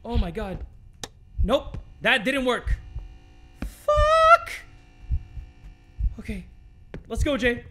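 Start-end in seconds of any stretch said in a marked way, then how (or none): 3.6-4.5 very high, loud startled cry; startled yell. Real, not acted. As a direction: yelp it out in real fright. Startled by the horror game.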